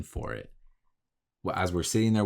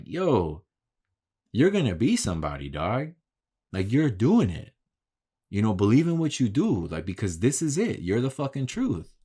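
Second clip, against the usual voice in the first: higher, emphatic pep talk; fired-up words of encouragement; hyping him up; 4 semitones higher, 3 dB louder than usual. Retold or imitated. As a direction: tell him with conviction he has real talent. Hyping up the young painter.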